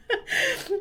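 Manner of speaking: real high-pitched laugh; high burst of laughter. Real, not acted. Laughing at asking Dana in Swedish, high laugh.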